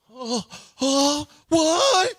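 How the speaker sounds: high, loud mock outrage; mock disbelief. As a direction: play a baffled player. Mocking Tim Duncan's disbelief.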